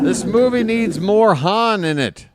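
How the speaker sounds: high joking complaint called out; hammed-up high yell; mock movie-goer yell. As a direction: holler it at the screen for laughs. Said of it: "I yelled the same thing".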